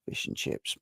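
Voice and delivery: whispered under the breath, no voice. Real, not acted. Reading chat guesses on stream.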